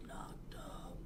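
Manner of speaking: slow, voiceless whisper; hushed whisper. Real, not acted. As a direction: whisper it like a family scandal. Scandalous word mouthed in family story.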